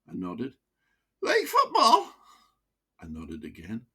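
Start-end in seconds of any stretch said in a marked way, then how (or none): 1.2-2.1 put-on high, broad Wearside voice of an old miner; chatty old pitman with a wheezy chest. Old miner to his doctor, broad Wearside.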